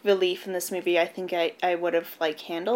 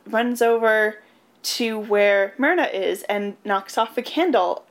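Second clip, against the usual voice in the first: ordinary delivery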